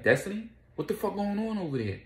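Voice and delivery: high, urgent imitated alarm. Charlie on the phone to Destiny, high and alarmed. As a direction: demand to know what happened. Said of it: "So he like".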